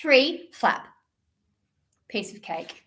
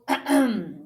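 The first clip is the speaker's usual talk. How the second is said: an awkward little throat clear; embarrassed kitten clearing throat. Acted. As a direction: cough to cover not knowing. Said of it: "coughed Max".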